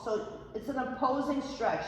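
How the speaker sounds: usual speaking voice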